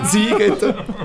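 slow, high laughing repeat. Real, not acted. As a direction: drag it out while laughing. Laughs while repeating the name.

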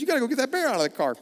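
wife hurrying him to deal with bear; urgent retold order. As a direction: rush it like an insistent wife. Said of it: "she said".

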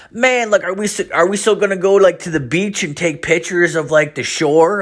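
high mock voice of the buddy; college buddy voice. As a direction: play the clueless old friend high. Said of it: "He's like".

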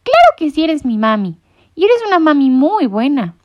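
a little bird sure of its mom; insistent chick. A narrator's reading. (pollito insistente, voz aguda)

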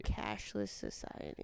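breathy whisper of "Cashless society", taking it slowly. Real, not acted. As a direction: whisper "Cashless society" carefully, low and slow. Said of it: "Try to say that".

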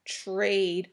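plain reading voice

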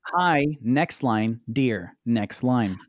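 slow, deadpan reading of the message; slow dictation. Retold or imitated. Reading the seller's message to Adam, slow deadpan. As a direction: spell out each line break slowly. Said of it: "I quote".

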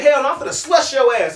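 high, loud imitated threat; mock fighting talk with force; heated threat. Resident threatening to beat up the storyteller, heated. Acted threat, corrected quote.

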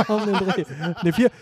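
words through real laughter; laughing. Real, not acted. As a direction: laugh while correcting the number. Lacht beim Zahlenraten. (lachend gesprochen)

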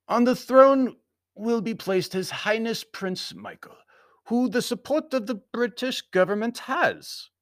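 high, slow proclamation; formal foreign Baron. A narrator's reading. Baron announcing the prince's succession, high formal voice. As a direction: pronounce it grandly, pitched well up.

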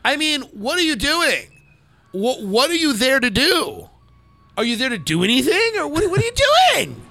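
rising, incredulous what are you doing; exasperated. Real, not acted. Host ranting at a caller, exasperated and high. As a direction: pitch climbing in disbelief.

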